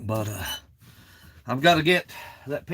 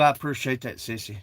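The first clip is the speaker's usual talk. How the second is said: normal talking voice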